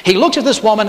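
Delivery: usual speaking voice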